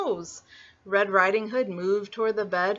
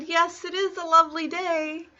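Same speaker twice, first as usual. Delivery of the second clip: put-on high-pitched child's greeting; polite little girl; 8 semitones higher than usual. Little Red greeting Mister Wolf, high and polite. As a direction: greet him in a small girl's high voice.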